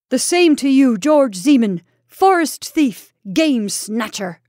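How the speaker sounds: loud, high, slow bitter insults; enemy hurling insults back, bitter and loud; bitter shout. Acted. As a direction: fling each insult at him. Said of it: "Both men spoke with the bitterness".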